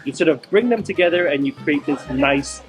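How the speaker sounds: ordinary delivery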